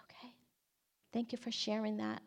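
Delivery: very soft, careful reply; hushed, gentle. Retold or imitated. Storyteller answers a hurting student, hushed and gentle. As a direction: barely voice it, taking in the pain.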